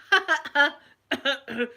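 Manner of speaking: loud, high "ha ha" bursts; imitated laughing. Me watching the spider on uncle's ear, laughing. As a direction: act out uncontrollable giggles. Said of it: "stop the laughing".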